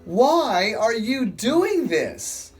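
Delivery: high, slow and pleading apostle voice; slow, lifted plea to the crowd; Paul preaching. Acted. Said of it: "Paul shouting".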